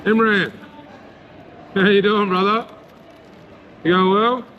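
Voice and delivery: a local calling out a warm hello to a friend; friendly called greeting. Retold or imitated. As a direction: call out a greeting to a mate you just spotted. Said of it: "Glenorchy's always been like".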